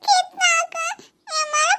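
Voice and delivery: put-on high, slow, tearful child's whine; a little girl sobbing for her uncle's attention; whiny child crying. Niece cries to her uncle to look at her.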